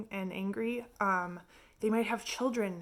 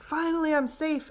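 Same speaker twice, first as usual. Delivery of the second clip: higher, louder burst of relief; relieved cry; 8 semitones higher, 6 dB louder than usual. Retold or imitated. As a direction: throw it out like a shipwrecked man landing. Reaching land after the storm.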